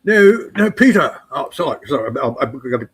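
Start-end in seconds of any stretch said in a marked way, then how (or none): 0.0-1.2 put-on dad's German accent, pitched up; mock German accent. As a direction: do the old German father. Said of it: "gotta do the accent".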